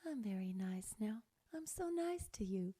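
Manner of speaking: soft, very sweet tones; relaxed nice. Shown as a demonstration. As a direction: breathe it out kindly. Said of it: "very relaxed".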